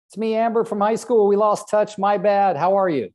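high, loud, bright reconnecting voice; bubbly phone greeting. Shown as a demonstration. Agent calling old classmate, cheery high loud hello.

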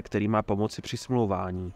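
usual speaking voice

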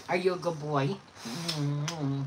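normal talking voice

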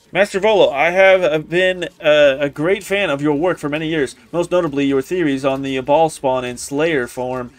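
low, formal letter-reading voice. Acted. Admirer writing to Master Volo, low formal reading. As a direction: read it as a scholarly invitation. Reads an in-game letter aloud.

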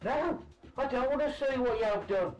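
aside to someone else. Real, not acted. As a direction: talk to your son in the next room. Caller talking aside to son.

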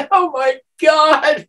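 high, slow and loud; mock horror. Woman recalling her mortified reaction, high and slow. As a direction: gasp it out in embarrassed disbelief. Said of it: "I'm like".

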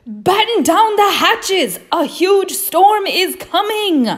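very high, loud warning shout; urgent cry. Acted. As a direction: shout the alarm to the whole crew. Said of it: "Henry cried".

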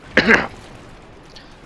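a loud, rough throat clear. Real, not acted. Gamer mid-stream clearing his throat, loud rasp. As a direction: clear the throat hard and high. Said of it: "Let's get a nice lovely cough".

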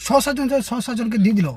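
ordinary talk

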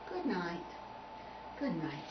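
subdued parting. Acted. Sad parting after rehearsal.

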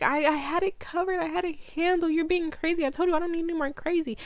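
high, fast huffy protest; high-pitched exasperated girl; mock indignant. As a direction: toss it off high and defensive. She snaps at Travis.

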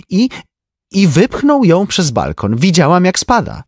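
high, lively society lady; a chatty rich woman gossiping; Tenzi's voice. A narrator's reading. (wysoki głos plotkującej damy)

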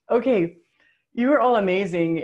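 moved almost to tears, pushing the words out; emotional, near tears. Real, not acted. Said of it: "I'm sorry I'm so emotional".

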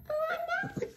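high squeal of the name, breaking into laughter; laughing squeal. Real, not acted. Owner laughing as she calls her dog Ohana, high squeal.

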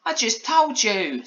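high, slow telling-off; Polly, firm. A narrator's reading. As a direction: spell it out like an insistent child. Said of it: "said Polly firmly".

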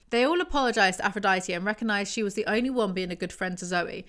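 normal talking voice

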